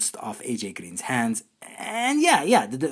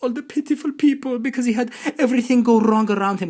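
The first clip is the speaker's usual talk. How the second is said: high, loud whiny mockery; sneering mock sympathy; mocking sarcasm; 11 semitones higher, 6 dB louder than usual. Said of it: "We're supposed to say".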